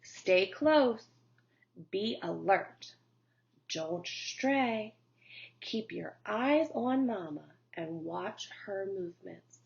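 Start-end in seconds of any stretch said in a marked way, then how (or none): none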